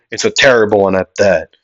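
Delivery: ordinary talk, nothing marked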